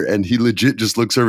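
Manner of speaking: usual speaking voice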